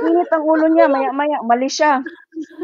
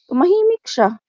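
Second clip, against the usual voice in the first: ordinary talk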